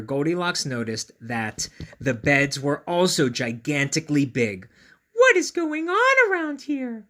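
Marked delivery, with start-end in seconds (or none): 5.1-7.0 put-on high, loud exasperated girl voice; high groaning girl; exhausted groan. A narrator's reading. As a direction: groan it out like a worn-out little girl. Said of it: "groaned the exhausted girl".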